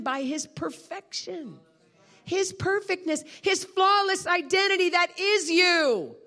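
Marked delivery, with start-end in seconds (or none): 2.2-6.2 high, slowing, building proclamation; fervent preaching. Real, not acted. Preacher tells congregation their value, fervent preaching.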